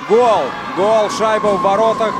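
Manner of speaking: loud high shouted goal call; excited goal call. Real, not acted. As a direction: erupt as the puck goes in. Goal scored, commentator erupts.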